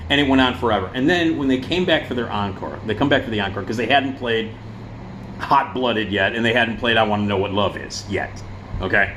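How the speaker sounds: ordinary talk, nothing marked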